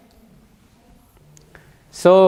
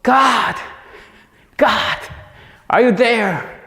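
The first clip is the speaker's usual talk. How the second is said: desperate cry to God; 11 semitones higher, slower than usual. Retold or imitated. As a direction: cry out to God in despair, voice up high. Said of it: "he just cried out".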